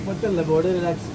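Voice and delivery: very loud call from the pool; shouting. Real, not acted.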